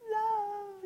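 high, dreamy "Love"; mock swooning schoolgirl; swooning girls. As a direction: sigh it like a lovestruck teen girl. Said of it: "all the girls do this".

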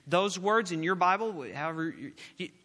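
ordinary talk

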